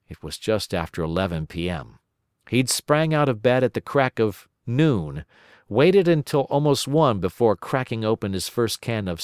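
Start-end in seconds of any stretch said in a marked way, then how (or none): none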